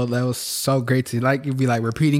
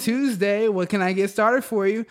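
high, drawn-out overly sweet greeting; impression of Sarah; 9 semitones higher than usual. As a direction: gush like a cheesy barista. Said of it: "it's time to put in my Sarah".

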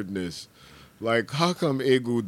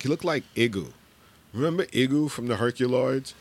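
ordinary talk

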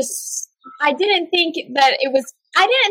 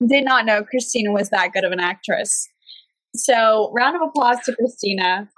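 normal talking voice

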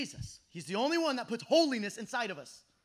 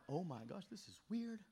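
stunned hush; 5 semitones lower, 13 dB quieter than usual. Retold or imitated. Stunned by strangers' words.

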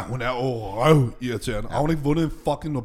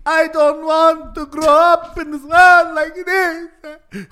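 shrill-free high slow shouted impression of Greta; mock Greta shout; 14 semitones higher, 10 dB louder, much slower than usual. Mocking Greta at climate protest, high slow shout. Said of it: "Hun lyder som sådan en italiensk".

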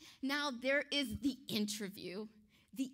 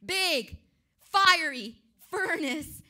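slow, booming word-by-word warning; loud, drawn-out menace as the king; emphatic royal threat; 11 dB louder, slower than usual. Acted. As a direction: drive each word home loudly. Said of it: "He's making sure you understand".

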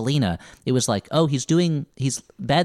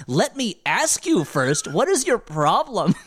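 mock indignant mother; 9 semitones higher, slower than usual. Retold mother confronting emperor.

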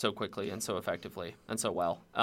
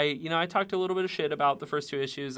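ordinary talk

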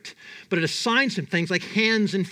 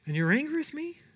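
high, softer, disbelieving question; incredulous question; 8 semitones higher, 3 dB quieter, faster than usual. Acted. God asking Jonah about his anger, incredulous. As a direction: lift it in hurt disbelief.

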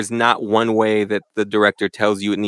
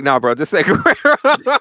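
laughing retort; 5 semitones higher than usual. Retold or imitated. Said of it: "I would personally be like".

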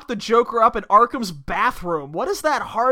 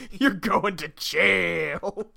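slow, softer mimicry of a stern hero; mimicked hero line; 3 semitones lower, 7 dB quieter, much slower than usual. Listener echoing the hero's verdict, mimicked hero line. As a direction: draw out the line in mock gravity.

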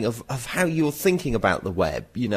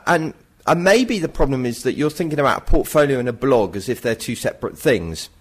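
ordinary talk, nothing marked

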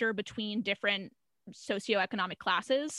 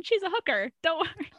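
higher, cheerful innocent chime; naive Coach; 7 semitones higher than usual. Retold or imitated. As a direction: chirp it out, sweetly clueless. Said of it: "Coach chimes in".

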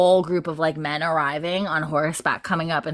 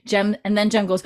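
normal talking voice

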